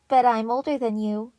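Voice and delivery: playful taunt to his brother; mock-superior kid brother; teasing. A narrator's reading. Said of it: "Blue teased Purple".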